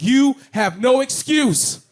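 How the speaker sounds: slow, high, loud motivational voice; dramatic inspirational voice-over; preachy narrator. Retold or imitated. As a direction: hammer home each word like a hype video.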